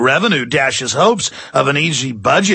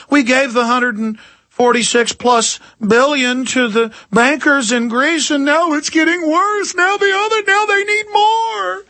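much higher mocking voice; mock whiny voice; 13 semitones higher than usual. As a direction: squeak it out like a greedy official begging. Mocking the bankers and government.

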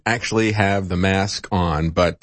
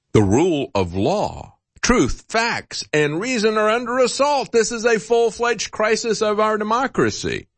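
lightly voiced Hillary, pitched higher; a hint of a lofty speech voice, higher; mock Hillary speech; 11 semitones higher than usual. Host mocking Hillary's speech to viewers, higher lofty delivery. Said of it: "She said".

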